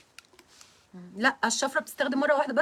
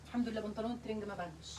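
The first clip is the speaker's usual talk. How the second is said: much softer relieved murmur; relieved aside; 3 semitones lower, 15 dB quieter, faster than usual. Real, not acted. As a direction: say it under your breath, relieved.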